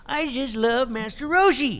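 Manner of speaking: high, loud cartoon old-man voice; Master Roshi voice. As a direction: pitch it way up like a cheeky old master. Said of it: "he's just such a fun character voice to do".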